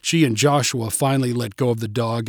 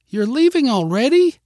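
put-on high, louder little-girl outcry; dismayed child's cry; 11 semitones higher, 5 dB louder than usual. A narrator's reading. Girl seeing the dog's owners leave, high dismayed cry. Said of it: "cried Mary".

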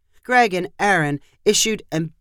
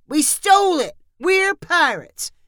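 put-on high, drawn-out kid's shout; child's proud shout; 11 semitones higher than usual. A narrator's reading. Boy pirate answering policeman, high shout back. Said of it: "Amos shouted back".

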